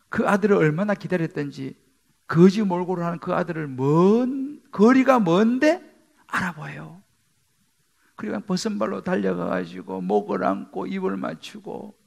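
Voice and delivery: ordinary delivery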